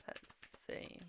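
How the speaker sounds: slow whisper under her breath; whispered muttering. Real, not acted. Reader pulling cards for Keith, slow muttered whisper. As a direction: hush it to yourself as you pull cards.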